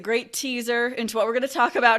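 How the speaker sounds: amused host laughing as she talks. Real, not acted.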